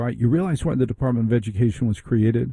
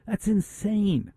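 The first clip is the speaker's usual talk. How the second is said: high, slow, softer exclamation; incredulous, pitched way up and softened; high-pitched disbelief; 8 semitones higher, 6 dB quieter, slower than usual. Real, not acted. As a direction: draw it out high in exasperated disbelief. Host exasperated at military rules.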